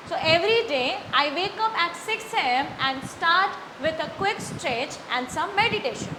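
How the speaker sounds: slow model reading, pausing at the comma and stopping at the full stop; read with pauses. Shown as a demonstration. Teacher models reading aloud with pauses at punctuation. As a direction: read it slowly, marking each punctuation mark. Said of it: "तो आधे second का pause".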